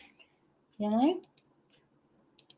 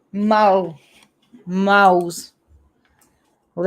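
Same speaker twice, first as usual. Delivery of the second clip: usual speaking voice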